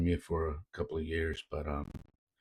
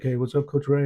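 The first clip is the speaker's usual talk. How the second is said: higher and louder, cheerful hey; excited greeting; 7 semitones higher, 8 dB louder than usual. Retold or imitated. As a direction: call it out to the coach. New player greets the coach.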